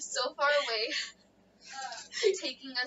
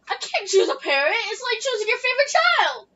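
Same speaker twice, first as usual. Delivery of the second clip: high, loud, rising wail of protest; exasperated protest; 9 semitones higher, 12 dB louder than usual. Real, not acted. Friend asked to choose a parent, loud protest. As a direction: protest loudly at an impossible choice.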